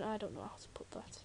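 breathy whispered aside to herself. Real, not acted. Gamer unsure of her words, whispering aside. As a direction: drop to a whisper, half to yourself.

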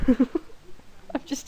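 slow, giggling through the words; laughing. Real, not acted. Laughing to viewers after her age joke. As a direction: let the laugh carry it.

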